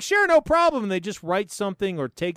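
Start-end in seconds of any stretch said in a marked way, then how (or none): 0.0-0.8 high, louder mock-eager yes; eager agreement. Institute agreeing to take the money, mock-eager. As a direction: chirp it like an instant yes.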